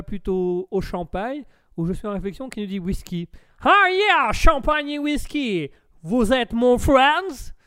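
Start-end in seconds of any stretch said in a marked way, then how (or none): 3.6-7.5 loud, slow hammed-up American; American accent. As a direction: boom it out as a drawling American. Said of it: "Et vous, l'Américain?". (gros accent américain, traînant)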